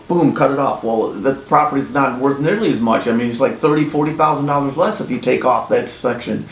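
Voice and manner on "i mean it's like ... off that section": usual speaking voice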